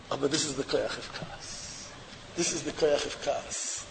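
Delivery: very soft, low, distant; near whisper. Real, not acted. Rabbi confiding to listeners, near whisper. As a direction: breathe it out softly.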